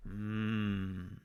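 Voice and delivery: low, soft, drawn-out mmm; long, yummy low hum; savouring mmm. Acted. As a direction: hum softly as if tasting something delicious. Savouring the thought of a s'more.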